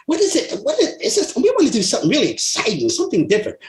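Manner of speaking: put-on high, eager girly voice, pitched well up; imitating an eager girl. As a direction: pitch way up like an excited young woman asking for fun. Girl asks Harold for excitement.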